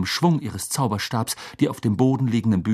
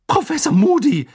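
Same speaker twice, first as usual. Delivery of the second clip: loud, high cry of shock; high-pitched, horrified exclamation; 6 dB louder than usual. A narrator's reading. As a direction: call out the name aghast. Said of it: "ertönte eine entsetzte Stimme".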